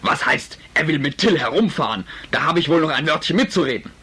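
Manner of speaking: lauter, empörter Vater; wütender Vater. Acted. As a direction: schimpf los, laut und aufgebracht. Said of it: "eine recht laute Unterhaltung". (Vater streitet mit Mutter, laut und wütend)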